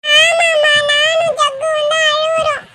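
put-on very high, whimpering kid voice; high childish crying voice; tearful child. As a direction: complain tearfully to mum like a little child. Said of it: "சரி, சரி, அழாதம்மா".

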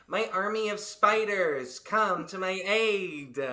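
slow, grand villain call; villain summoning. Acted. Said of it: "by saying".

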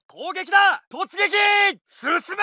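high charge shout; rally them into the attack; charge cry. Acted. Ordering a charge.

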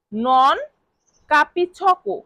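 a long pause between the words. Shown as a demonstration. Teacher breaking the phrase apart for learners, word by word. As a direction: lay the words out one at a time. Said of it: "এর Italian বাক্য হবে".

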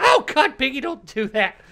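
high, loud yelp of alarm; jump, then scold in a high voice; startled. Real, not acted. Gamer startled by Pinky in the cave, startled.

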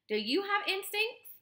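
put-on high young gosling question; high-pitched child-like gosling voice; curious gosling. A narrator's reading. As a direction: ask it high, lifting at the end.

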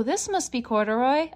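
plain reading voice

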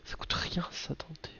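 whispered aside to himself. Real, not acted. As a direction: breathe it out, almost no voice. Aside while checking card price.

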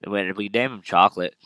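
usual speaking voice

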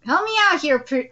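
ordinary delivery

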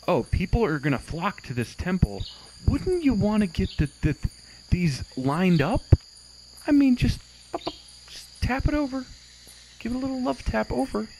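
mock developer thoughts. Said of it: "and you think".

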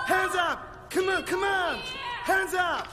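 high, loud barked orders; shouted commands. Acted. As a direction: storm in yelling. Soldiers storming and shouting orders.

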